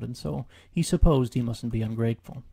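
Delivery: ordinary delivery, nothing marked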